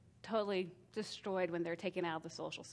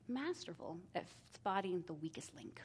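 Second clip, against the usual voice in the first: breathy confiding whisper, softer; 2 semitones lower, 7 dB quieter than usual. Real, not acted. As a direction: lean in and whisper it like a secret. Confiding aside to parents.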